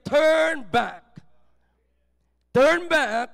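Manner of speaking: loud, emphatic preacher's call; big ringing scripture quote. Acted. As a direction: proclaim it forcefully, word by word. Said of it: "and with a loud voice".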